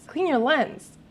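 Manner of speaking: exasperated high command; insistent high repeat. Real, not acted.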